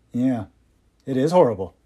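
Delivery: ordinary delivery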